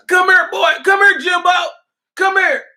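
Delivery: high, loud mocking call to a servant; hammed-up master hollering for his servant; mock slave owner calling. Master calling Jimbo over from the porch, mocking high holler.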